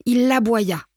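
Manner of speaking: ordinary delivery, nothing marked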